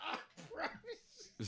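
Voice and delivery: breathless, laughing through the words; real laughter. Real, not acted. Co-host reacting to the story, real laughing. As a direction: wheeze it out mid-laugh.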